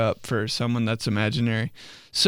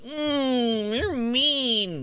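mock sulky whine; 11 semitones higher, 4 dB quieter, much slower than usual. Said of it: "And then being like".

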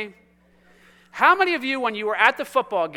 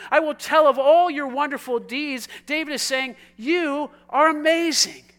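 raised, fervent sermon voice; impassioned preacher, higher pitch with punched key words; forceful preaching; 4 semitones higher, 8 dB louder than usual. Real, not acted. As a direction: drive it home to the congregation with big emphasis.

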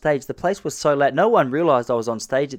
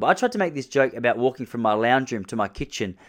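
ordinary talk, nothing marked

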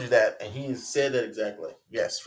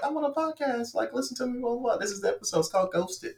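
put-on excited girl voice; 14 semitones higher than usual. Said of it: "Be like".

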